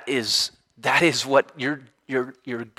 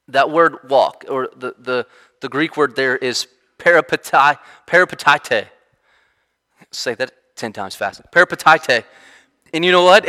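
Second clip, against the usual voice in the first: ordinary talk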